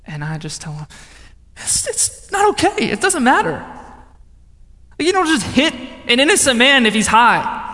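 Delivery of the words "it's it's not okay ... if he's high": high-pitched, upset objection; re-enacted outrage; indignant protest. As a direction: plead and scold at once, voice jumping up. Retold confrontation with the gang.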